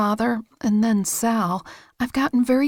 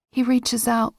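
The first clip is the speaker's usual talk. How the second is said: ordinary delivery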